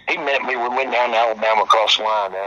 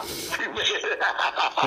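soft laugh; 9 semitones higher, 8 dB quieter than usual. Real, not acted. Laughing at his own story.

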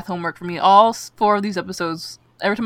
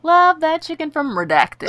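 higher, slow, ad-announcer tone; mock ad read; 9 semitones higher than usual. Joking fake sponsorship, mock ad voice. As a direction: sell it like a commercial.

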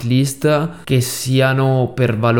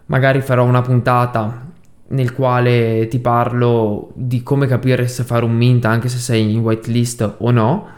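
ordinary talk, nothing marked

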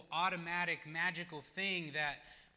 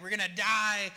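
panicked cry; 5 semitones higher, 9 dB louder than usual. Retold or imitated. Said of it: "They're like".

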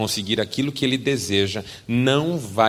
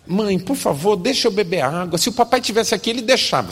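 put-on higher, desperate little boy; pleading child; 9 semitones higher, 4 dB louder than usual. Son begging mother for water, higher pleading. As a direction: beg in a child's high voice.

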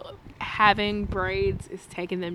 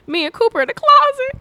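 high frantic text voice; 12 semitones higher, 4 dB louder than usual. Retold or imitated. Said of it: "She was like".

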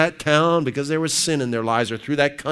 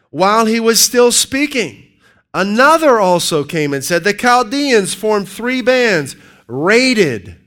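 preaching scripture; 6 semitones higher, 7 dB louder than usual. Acted. Preacher reading First Kings aloud at full force. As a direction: thunder out the verse.

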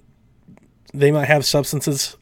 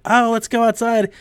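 mock girl; 10 semitones higher than usual. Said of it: "that girl's all like".

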